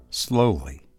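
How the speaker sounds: ordinary delivery, nothing marked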